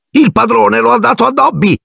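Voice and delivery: very high, squeaky elf voice, overjoyed; Dobby, ecstatic. A narrator's reading. Elf marvels at the master's gift of a sock, ecstatic. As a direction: squeal it like an enraptured little elf. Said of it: "ripeté".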